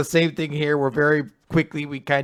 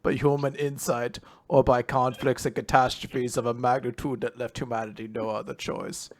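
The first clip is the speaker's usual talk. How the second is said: Henry Kissinger. Acted. Reading a quote in the statesman's voice, droning impression. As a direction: recite it like a droning elder statesman. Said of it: "look up Henry Kissinger quotes just so I could like, channel them".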